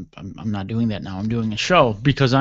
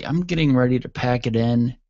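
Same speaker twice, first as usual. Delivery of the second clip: ordinary delivery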